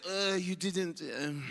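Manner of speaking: French correction. Retold or imitated. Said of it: "that's the French correction".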